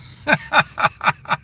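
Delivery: high airy chuckle; a quick giggle at his own joke; amused giggle on stream; high breathy laugh. Real, not acted.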